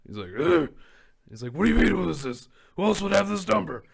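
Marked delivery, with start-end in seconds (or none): 0.4-0.7 loud, higher throaty grunt; raspy grunt. Retold or imitated. Imitating Batman with his voice gone, raspy grunt. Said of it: "I just fucking lost my voice". 1.5-3.8 high, loud strained rasp; Batman losing voice. Retold or imitated. Batman phoning the commissioner with voice gone, raspy. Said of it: "at the very end, he calls".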